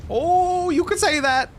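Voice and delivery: drawn-out "oh", pitched high and loud; high loud exclamation. Acted. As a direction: cry out in emphatic agreement. Exclaims reply to game line.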